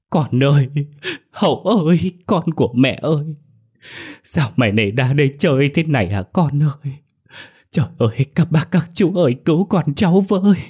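mother's high, loud cries of grief; anguished wailing. Acted. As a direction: wail for your child, building to a desperate plea for help. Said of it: "Chị Luyến đau xót gào khóc".

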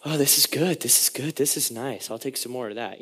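soft, low satisfied murmur; contented. Acted. Israelites tasting the sweet water, soft contented voice.

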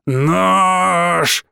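one long drawn-out menacing word; snarling. A narrator's reading. Madman bares teeth at the boy, snarling. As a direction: stretch the word out as a threat. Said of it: "оскалился Хас".

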